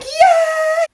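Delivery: high, loud cheering yeah. Retold or imitated. Nurses cheering her first words, a loud high yeah. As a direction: celebrate like a room of nurses.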